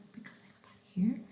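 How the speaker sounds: soft, quick whisper. Real, not acted. Remarking that nobody else is around, whispering. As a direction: drop into a hurried whisper. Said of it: "I don't know why I'm whispering".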